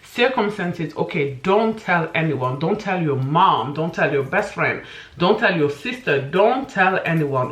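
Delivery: ordinary delivery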